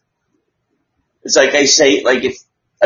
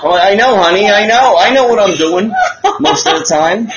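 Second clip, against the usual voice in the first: laughing; 5 semitones higher than usual. Real, not acted. Laughing at wife joke.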